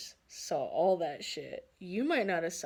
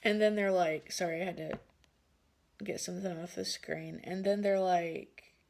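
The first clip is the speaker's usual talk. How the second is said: ordinary talk, nothing marked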